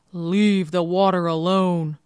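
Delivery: slow, loud monster warning; loud, forbidding reptile voice, slow; menacing giant alligator. Acted. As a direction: draw it out as a huge alligator. Giant alligator warns the girl.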